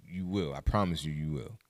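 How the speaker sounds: ordinary talk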